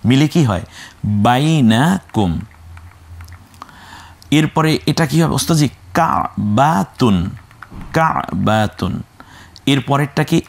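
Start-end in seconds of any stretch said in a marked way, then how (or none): 1.3-2.3 বাইনাকুম drawn out syllable by syllable; slow model pronunciation. Shown as a demonstration. Teacher models the joined word. 6.0-8.9 কাবাতুন broken after the first syllable, drawn out; slow model pronunciation. Shown as a demonstration. Teacher models word twice.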